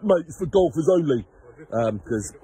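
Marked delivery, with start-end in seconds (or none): 0.0-1.3 lightly voiced grumpy jobsworth, a little higher; hint of a grumbling car park attendant; mild mock-grumpy quote, pitched up; grumpy kiosk man. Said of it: "the grumpy bugger on that kiosk".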